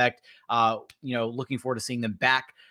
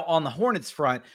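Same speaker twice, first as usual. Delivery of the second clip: ordinary talk, nothing marked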